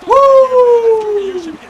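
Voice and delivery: high, loud, drawn-out cheer; real victory "woo", held long; long whoop. Real, not acted. Cheering the winning play.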